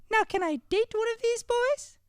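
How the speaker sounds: put-on very high girlish voice; daughter voice. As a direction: speak as the sweet daughter.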